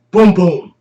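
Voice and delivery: shouted boom boom; loud booming sound effect. Acted. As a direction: belt out the bangs.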